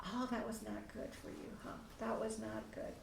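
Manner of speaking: put-on soft, gentle sympathy to a child; soothing parent. Shown as a demonstration. Parent comforting upset child, soft and gentle. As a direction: coax the child softly, hushed at the end. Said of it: "You're gonna be just kinda going".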